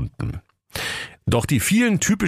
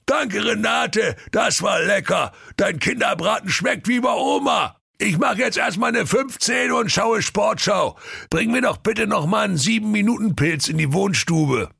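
booming contented ogre voice; a full ogre lazily ordering; satisfied ogre; 12 semitones higher than usual. Acted.

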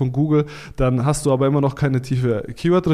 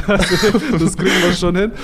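laughing, higher and louder reassurance; 6 semitones higher, 4 dB louder than usual. Real, not acted. Guest laughs as he promises the host a number, laughing. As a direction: chuckle it out while promising.

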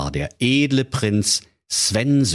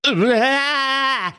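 high horrified king cry. A narrator's reading. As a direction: recoil in disgust. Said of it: "rief der König entsetzt".

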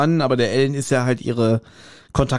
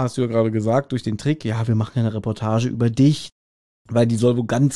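ordinary talk, nothing marked

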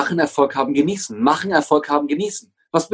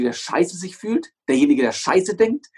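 normal talking voice

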